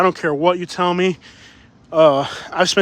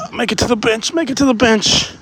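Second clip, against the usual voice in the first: winded, pushing the voice higher; out of breath; 3 semitones higher than usual. Real, not acted. Winded vlogger urging himself toward a bench, out of breath. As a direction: urge yourself on through heavy breathing. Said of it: "I'm so out of breath".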